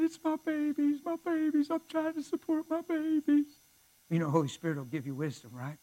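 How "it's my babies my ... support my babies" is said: imitated crying. Woman pleading about her babies, imitated crying. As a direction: whine it tearfully in a high imitating voice. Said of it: "She started crying".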